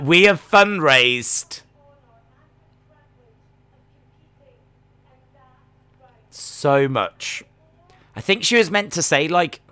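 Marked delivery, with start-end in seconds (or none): 0.0-7.4 slow, halting mock promo delivery; imitating halting speaker. Mocking a wrestler's emotional fundraising speech, slow and halting. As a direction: imitate a promo stalling mid-sentence. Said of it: "She's like".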